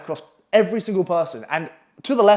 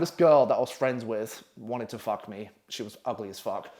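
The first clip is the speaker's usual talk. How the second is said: plain reading voice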